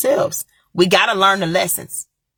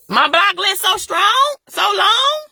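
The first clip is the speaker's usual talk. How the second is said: sassy boast; 11 semitones higher, slower than usual. Real, not acted. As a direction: crow it with sass. Boasting about blocking trolls.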